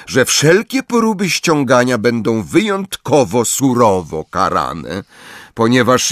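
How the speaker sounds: formal, emphatic schoolmistress; stern professor. Acted. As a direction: announce exam rules to a class, measured and strict. Professor addresses her class.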